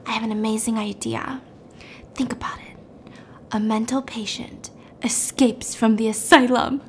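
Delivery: half-whispered, soft and intense; dramatic hushed pitch. Retold or imitated. Wife pitching her script to her husband, hushed. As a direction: breathe the movie idea out dramatically.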